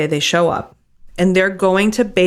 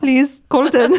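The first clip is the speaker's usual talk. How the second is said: higher, slow, hammed-up European accent; mock pleading engineer accent; 6 semitones higher, slower than usual. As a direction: beg Colton like a desperate engineer. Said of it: "Our accents are so off".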